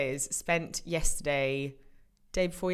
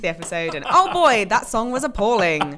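mock Brooklyn complaint; 6 dB louder than usual. Reading Chance's email in voice.

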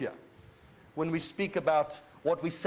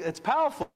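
preacher's rising emphasis; emphatic; 7 semitones higher than usual. Real, not acted. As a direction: drive home it's powerful.

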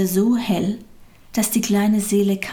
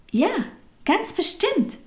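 high-pitched, lightly amused assurance; giggling reassurance; 11 semitones higher than usual. A narrator's reading. Friendly soul reassuring little soul, lightly giggly. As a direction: say it with a hint of a giggle. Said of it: "kicherte die freundliche Seele".

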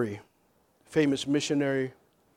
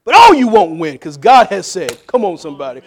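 loud, defiant preacher's burst easing off; preach; 3 semitones higher, 12 dB louder than usual. Real, not acted. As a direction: declare it defiantly to the congregation. Preaches at full force.

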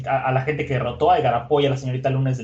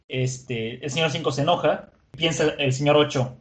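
usual speaking voice

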